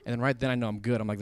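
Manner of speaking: ordinary talk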